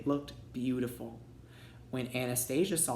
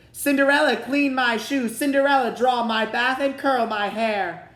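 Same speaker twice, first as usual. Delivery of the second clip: much higher, louder, bratty command; bossy order; 9 semitones higher, 8 dB louder than usual. A narrator's reading. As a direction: yell orders like a spoiled stepsister. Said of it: "they ordered".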